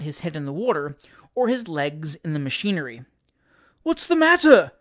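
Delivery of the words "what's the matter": loud, high, frantic question; agonized shout. A narrator's reading. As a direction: shout in a father's alarm. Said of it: "shouted the agonized father".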